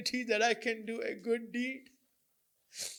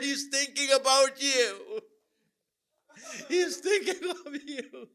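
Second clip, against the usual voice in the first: loud, raised, tearful lament; impassioned mourning sermon; 4 semitones higher, 6 dB louder than usual. Acted. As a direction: build to a wailing plea to the congregation.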